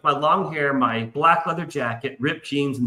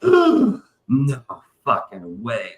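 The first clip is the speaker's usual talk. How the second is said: can't believe it, voice dropping away; stunned disbelief; 4 semitones lower, much slower than usual. Retold or imitated. Fan hears the Hard Rock will shut, stunned disbelief. Reacting to closure news.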